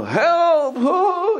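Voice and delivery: very high, loud hammed-up cry of Help; mock cry for help. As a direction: shriek it like a panicked victim. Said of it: "he doesn't yell anything like that".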